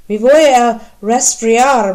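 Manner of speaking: complaining she's freezing; mild shivering. A narrator's reading.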